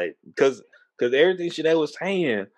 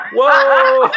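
loud, high startled whoa; sudden loud shocked exclamation; 11 semitones higher, 11 dB louder than usual. Retold or imitated. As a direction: jolt back in shock at the insult. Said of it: "I'm like".

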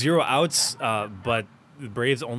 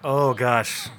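overwhelmed exclamation; 3 semitones higher, 6 dB louder, much slower than usual. Real, not acted. Overwhelmed by long question.

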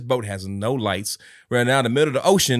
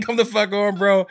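laughing; 8 semitones higher than usual. Retold or imitated. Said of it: "I'm like".

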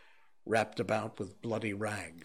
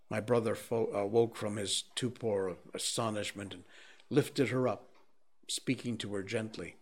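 plain reading voice

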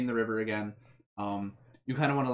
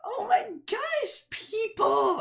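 much higher, shrieking excited squeal; 4 dB louder, much slower than usual. Acted. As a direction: squeal it like a giddy kid meeting people. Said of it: "she's like".

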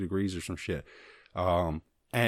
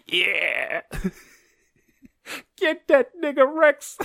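much higher, louder laughing cheer; stoner hype yell; 5 dB louder, much slower than usual. Retold or imitated. Stoned viewer cheering a battle rap, high laughing yell. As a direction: whoop like a fan at a battle rap.

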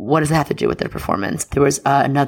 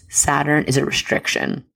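usual speaking voice

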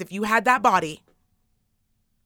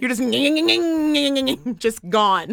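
breaking up into high laughter; words squeezed out through a laugh; laughing too hard to finish; 6 semitones higher, 5 dB louder than usual. Real, not acted. Laughing fit.

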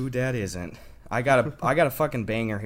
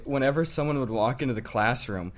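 ordinary talk

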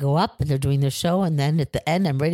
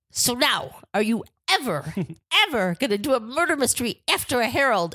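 higher, emphatic mock interrogation; retold confrontation; 6 semitones higher than usual. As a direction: imitate a furious teacher demanding an answer. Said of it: "nose to nose with them".

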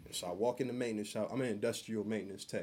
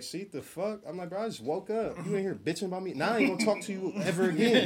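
higher, loud and fed up; exasperated retort; 8 semitones higher, 5 dB louder than usual. Retold or imitated. Retelling a complaint at work, higher exasperated retort. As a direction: snap back, done with them.

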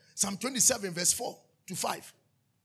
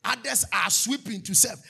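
usual speaking voice

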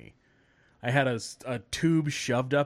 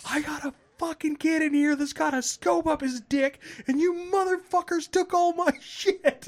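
much higher, louder mock yell; retold scream; 4 dB louder than usual. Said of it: "Just scream at 'em".

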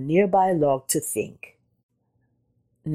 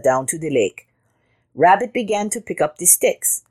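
plain reading voice

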